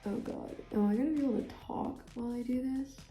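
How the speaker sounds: talking while applying eyeliner; distracted, concentrating on makeup; doing eyeliner while talking. Real, not acted. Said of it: "I guess the answer is no".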